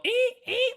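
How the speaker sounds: very high mock shower-scene screech; Psycho stabbing screech. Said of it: "like the Psycho thing".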